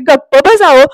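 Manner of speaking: mock scolding yell. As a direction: imitate a wife telling him off. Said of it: "असं ईश्वरी ओरडते". (खोटं रागावून ओरडणं)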